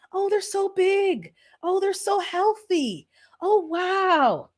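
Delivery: put-on high, slow, over-sweet cooing at a baby; high drawn-out mock-delighted cooing; fake gushing praise. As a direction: gush with strained politeness over an ugly newborn. Finding polite words for ugly baby.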